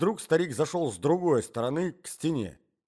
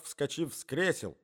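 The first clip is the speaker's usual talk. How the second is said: ordinary delivery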